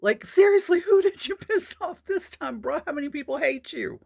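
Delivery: high, loud exasperated shout; exasperated yell. Retold or imitated. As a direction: chew out a boyfriend, loud and high. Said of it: "I'm like".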